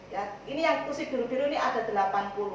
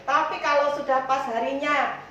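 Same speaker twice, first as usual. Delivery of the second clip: usual speaking voice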